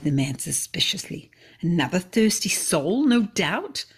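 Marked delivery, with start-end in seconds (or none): 1.6-3.8 mild mock growl, lightly raised. Elzevir sneering at the approaching stranger, mild growl. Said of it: "he growled".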